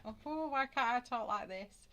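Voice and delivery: high-pitched imitation London-Essex accent; southern English accent. Admiring the accent down south, imitated London-Essex accent. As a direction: put on a light London-Essex accent, wistfully. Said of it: "I've loved that accent".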